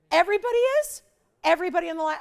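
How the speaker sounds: loud, high astonished question; shocked disbelief. Real, not acted. Host learns callers are all waiting, loud high astonishment. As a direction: exclaim it way up high in real surprise. Just told everybody is waiting.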